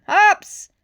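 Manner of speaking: loud, high, rising summons; angry yell. A narrator's reading. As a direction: call her in from the other room. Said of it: "Chief Bogo yelled".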